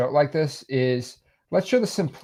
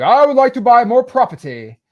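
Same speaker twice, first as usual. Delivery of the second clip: much higher and louder, haughty rich-man voice; snobby rich guy; 9 semitones higher, 8 dB louder than usual. Retold or imitated. Teacher voicing the rich buyer, haughty and high. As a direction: puff up like a greedy tycoon. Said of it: "And he's like".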